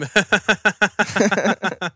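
cackling witch laugh. Acted. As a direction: let out a hammy witch cackle. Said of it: "That's my witch laugh".

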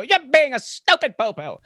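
put-on high-pitched childish insult; mock indignation. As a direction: hurl a silly insult as an irate customer, in jest. Acting out an angry customer.

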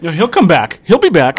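loud mock reassurance; sarcastic. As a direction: insist it, dripping sarcasm.

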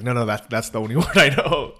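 half-laughing, insisting that's all I know; laughing protest. Retold or imitated. Telling the Russian granny he only knows one word, laughing. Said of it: "I'm like".

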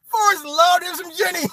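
high, squeezed voice breaking into laughter; through laughter. Real, not acted. Mocking Forrest's love for Jenny, helplessly amused. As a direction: barely get it out while cracking up. Still cracking up at own joke.

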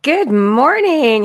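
loud, slow, bright sing-song greeting; chipper good morning. Real, not acted. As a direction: stretch out a cheery good morning. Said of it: "I'm too chipper this morning".

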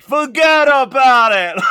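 put-on loud, high wiseguy New Jersey accent; Jersey wiseguy, big and brash; New Jersey tough guy. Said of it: "is just walking around like".

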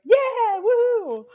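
high, loud party whoop; excited whoop. Retold or imitated. Friend shows how outgoing they are, loud high whoop.

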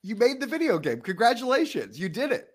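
high-pitched, teasing cheer; lightly mock-proud applause in the voice; playful congratulations. Real, not acted. Co-host playfully congratulates the coder.

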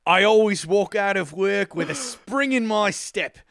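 hammed-up, rough New Zealand accent. Host reading a listener's review, hammed-up New Zealand accent. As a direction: put on your best amateur New Zealand accent. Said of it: "do my best New Zealand accent".

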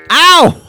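sudden high, loud "ow"; pained yelp. Acted. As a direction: yelp as if stung by a snapped rubber band. Snapped with rubber band.

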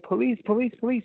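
high, shrieked cries for the police; a frantic high-pitched call for help. Retold or imitated. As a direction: shriek for the police across the station. Calling for police in the station.

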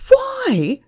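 very high, loud outraged shout; shouted, incredulous. A narrator's reading. Manager echoing Emma's question, outraged shout. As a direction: throw the question back in a shout. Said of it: "the manager shouted".

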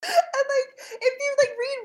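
high, breaking with laughter; laughing. Real, not acted. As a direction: talk through a laughing fit. Laughing fit while talking.

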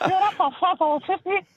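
very high comedy cabbie voice; high-pitched taxi driver. Acted. Caller shows off his cab driver impression on air, high-pitched voice. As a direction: keep up the squeaky falsetto. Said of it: "Just a high-pitched taxi driver".

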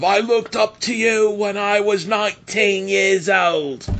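put-on a botched Irish accent, slow and high; imitating Becky in a bad Irish accent; failed Irish accent. Wrestling podcaster mimics Becky to her idol, failed Irish accent. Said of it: "that wasn't an Irish accent".

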